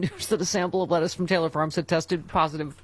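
ordinary talk, nothing marked

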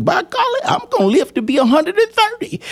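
imitating Kenneth. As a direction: play the old man bragging he'll live forever. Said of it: "He said".

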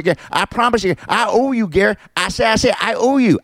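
high, stammering cartoon rooster; mock overseer as a squawky cartoon rooster; Foghorn Leghorn. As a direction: apologize in blustering rooster repetitions. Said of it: "Foghorn Leghorn, who's overseeing the whole".

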